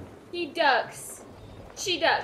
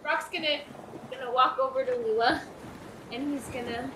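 ordinary delivery

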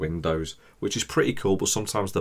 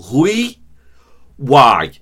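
mocking a posh, high-pitched, loud "Oui"; poncy chef; 7 semitones higher, 13 dB louder than usual. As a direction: ham up a pretentious chef. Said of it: "it's so poncy".

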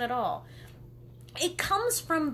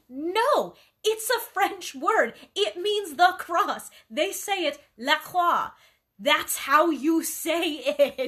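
high, loud, slow lecturing; insistent correction; 4 semitones higher, 6 dB louder, slower than usual. Retold or imitated. Correcting someone who says LaCroix, emphatic and loud. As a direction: set them straight, emphatic. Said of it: "I'm like".

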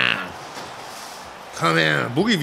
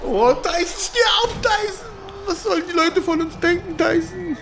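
pleading; 10 semitones higher, 5 dB quieter than usual. Pretend. Begging his downed boxer to rise.

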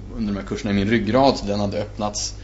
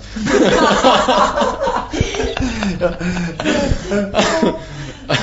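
hearty laugh; 11 semitones higher than usual. Real, not acted. Long laugh at his own joke.

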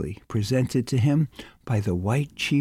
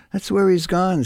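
higher, louder, knowing announcement; gleeful gossip, louder and higher; eager gossip; 7 semitones higher, 5 dB louder than usual. Acted. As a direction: blurt it out like hot news. Lenehan insists on his gossip.

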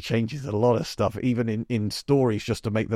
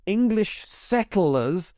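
slow, high, heavy stress on "settlers"; "settlers" drawn out and pitched up; 8 semitones higher, much slower than usual. Shown as a demonstration. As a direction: lean hard on "settlers" slowly. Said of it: "emphasis on the word settler".

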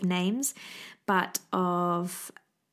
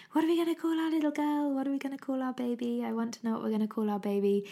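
higher, quick, pestering repeat of the question; imitating her husband asking over and over; eager husband nagging; 5 semitones higher, faster than usual. Wife mimics husband pestering about baby names, fast and high. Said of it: "he's constantly going on about".

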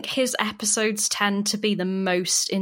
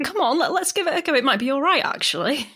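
quick, much higher coaxing voice; mock eager band boy, pitched way up; eager boys mimicked; 7 semitones higher, faster than usual. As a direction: hurry it out high, talking the others into it. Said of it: "And the boys are like".

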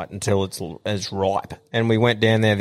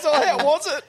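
laughing dad imitation. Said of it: "he goes".

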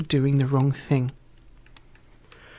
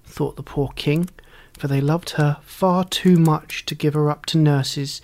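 ordinary delivery, nothing marked

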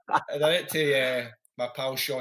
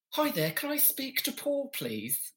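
much higher, put-on phone caller voice; camp caller voice; 12 semitones higher, 5 dB quieter, slower than usual. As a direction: play a prim fake pollster. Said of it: "So I kept doing this voice".

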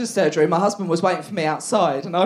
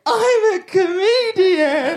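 slow, higher, crying; tearful; 10 semitones higher, much slower than usual. Retold or imitated. Answering her doctor through tears, crying. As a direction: sob the answer out.

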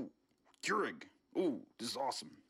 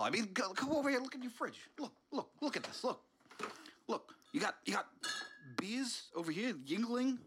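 Bernie voice; 7 semitones higher than usual. Acted. Caught in the kitchen, Bernie explains himself in a higher voice. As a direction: play Bernie caught red-handed, all innocence.

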